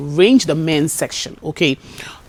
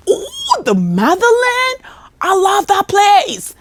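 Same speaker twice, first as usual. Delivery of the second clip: much higher, slow, swooning over the motherland; gushing delight; 4 dB louder, much slower than usual. Retold or imitated. Customer mimicked gushing over Ghana, high and slow. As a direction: gush like a thrilled American tourist.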